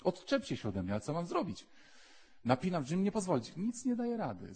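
straining, rising high against an unseen force; possessed man struggling. Acted. A tormented man resisting the pull, straining high. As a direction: fight it off, voice climbing.

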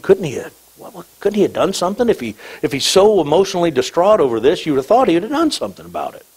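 high, hurried grumbling of onlookers; mock complaining. Voices the carping onlookers' complaint.

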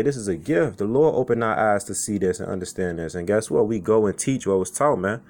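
ordinary talk

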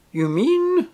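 high, loud theatrical shock; hammed-up injured question; wounded tragedian. A narrator's reading. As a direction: recoil in dramatic disbelief. Tragedian reacts with wounded drama.

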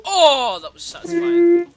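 high, loud satisfied exclamation; loud relish. Real, not acted. Player relishing a moment in the game, loud relish. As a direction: let out a big drawn-out cry of satisfaction.

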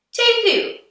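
ordinary talk

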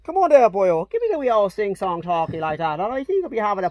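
hammed-up Irish lilt from Cork; Cork accent. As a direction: tease with a lilting put-on Cork accent. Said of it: "I wanna hear your Cork accent".

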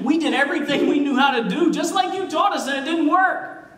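high-pitched, acted bafflement; frustrated protest. Disciples complain privately to Jesus after failing, frustrated protest. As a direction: protest that you followed every instruction.

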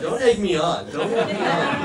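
laughing through "don't egg me on"; giggling, pleading with the crowd; laughing protest. Real, not acted.

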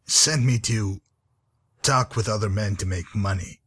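normal talking voice

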